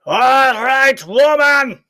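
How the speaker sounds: loud, high booming bellow of a giant. A narrator's reading. Giant bellowing at the door to Oonagh, loud booming bellow. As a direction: bellow it through the door like a huge brute. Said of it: "bellowed the giant at the door".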